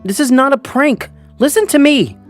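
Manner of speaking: loud, high-pitched urgent plea; pleading. A narrator's reading. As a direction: plead desperately to be heard. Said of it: "I kept pleading with them".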